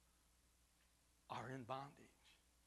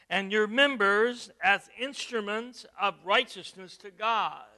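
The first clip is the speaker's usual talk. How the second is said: loud, slowed pulpit reading; scripture read with force; 12 dB louder than usual. Acted. Pastor reading Romans six to his church, loud. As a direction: proclaim the verse. Preacher reads Romans aloud.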